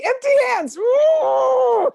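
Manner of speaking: high, loud whoop of fake effort; mock workout cheer. Faking a workout for the trainer, a high loud whoop. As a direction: cheer like you're crushing the workout.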